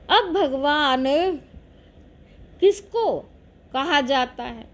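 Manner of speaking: ordinary delivery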